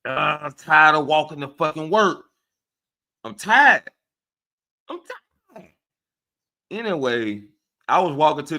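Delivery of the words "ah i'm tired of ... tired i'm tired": fed-up mock whine, louder, breaking into a cry; rising strained complaint cracking into a sob; exasperated whine. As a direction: act out being sick of walking to work. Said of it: "I'm like".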